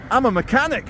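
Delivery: a high, mock-earnest answer; lightly voiced confused man replying; imitating older man. Older guy misunderstands the interviewer, in a high mock voice. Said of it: "And he goes".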